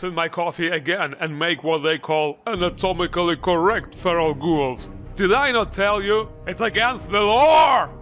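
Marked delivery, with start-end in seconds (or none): 5.1-7.9 outraged exclaim. Real, not acted. Ranting mock outrage.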